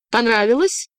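high taunting question. Acted. Girl asking the man she just struck, taunting. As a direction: needle him with a lifted, rising voice.

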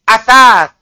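"Asas." with the tongue tip at the upper teeth, said as athar; Arabic th sound. Shown as a demonstration. Teacher shows the student the th in "Asas.". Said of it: "Ujung lidah, ujung gigi atas".

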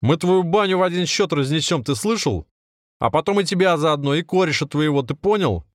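high-pitched angry threat, moderate volume; threatening shout. A narrator's reading.

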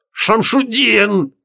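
high, very slow, drawn-out wail; wounded howl. A narrator's reading. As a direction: howl the name like a wounded beast. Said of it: "взвыл раненым зверем Креол". (протяжный высокий вой раненого зверя)

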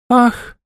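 theatrical fainting gasp; overacted death scene. A narrator's reading. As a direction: gasp and swoon dramatically. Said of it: "изобразив беспамятство и переутомление".

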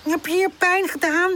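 high-pitched, concerned question; high, caring storybook voice; worried. A narrator's reading. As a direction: ask anxiously if your friend is hurt. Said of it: "vraagt Buurpoes bezorgd".

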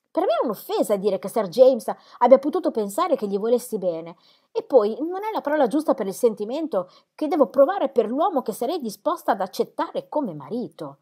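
offended Dorothea. A narrator's reading.

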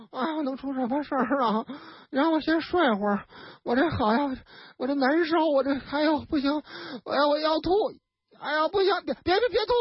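put-on groggy drunk voice, rising as she gags; drunk and nauseous. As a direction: play a sleepy drunk about to throw up. Drunk Guo Dasao about to vomit.

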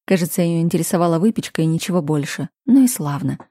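ordinary delivery, nothing marked

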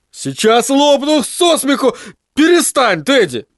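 высокий мальчишеский голос, лёгкая игра смеха; умоляй сквозь смех; Крис стонет от смеха. Acted. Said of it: "стонал Крис". (Крис, задыхаясь от смеха, просит Тедди)

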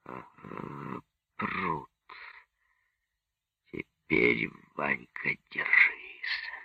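very soft, low, near-whisper; lips-only murmur. A narrator's reading. Officer at the stereo scope talking to himself, lips-only murmur. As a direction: breathe it out barely voiced, with pauses. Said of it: "он произнёс одними губами".

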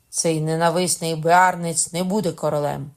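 very loud, emphatic vow; crying out. A narrator's reading. Katherine swears rival won't reign, crying out. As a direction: declare it forcefully against a hated rival. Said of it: "скрикнула Катерина".

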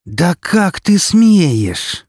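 very slow, high, seething hiss. A narrator's reading. Yudina hissing at the intern, seething. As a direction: drag out the hissed outrage. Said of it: "прошипела она".

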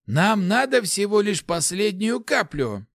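high, slow, insistent voice; drawn-out, high-pitched insistence; exasperated urging. A narrator's reading. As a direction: press him for one last effort. Lars urges paladin to focus.

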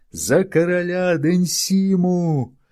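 high, slow roaring crowd cry; crowd yelling. Acted. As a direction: bellow it back as a crowd. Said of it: "завопила толпа". (рёв толпы)

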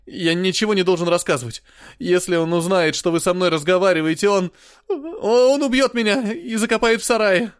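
high, loud, slowing terror; terrified. A narrator's reading. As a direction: blurt it out in terror. Terrified boy fears father.

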